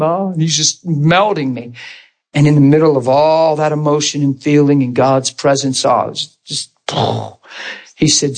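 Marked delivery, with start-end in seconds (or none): none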